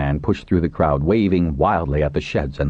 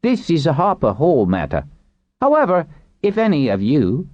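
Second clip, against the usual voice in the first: higher-pitched character voice; official addressing captives; 12 semitones higher than usual. Acted. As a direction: address the prisoners formally in a raised pitch. Narrator voices Pinch addressing captives.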